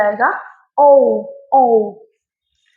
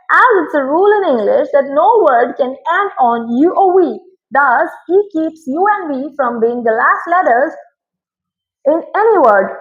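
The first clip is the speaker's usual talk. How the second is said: slow, careful reading of the rule text; reading a rule aloud; 3 semitones higher, 3 dB louder, much slower than usual. Acted. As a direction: read the English rule out slowly. Reads English rule aloud. (नियम को धीरे-धीरे पढ़कर सुनाना)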